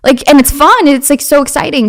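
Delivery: high, loud, eager rush; excited gushing. Retold or imitated. Homeschooled girl sharing love of learning, excitedly gushing. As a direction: gush with a child's keenness.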